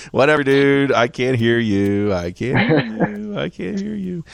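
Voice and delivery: high, drawn-out singsong brush-off; mock dismissive. Co-host pretending music drowns out the critics, mock dismissive. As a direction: stretch it out loud, then let it fade.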